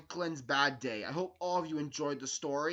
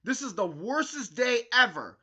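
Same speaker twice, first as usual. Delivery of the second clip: higher, louder storybook outburst; temper tantrum; 6 semitones higher, 6 dB louder than usual. A narrator's reading. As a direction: throw it out like a child losing his temper. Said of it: "Franklin lost his temper".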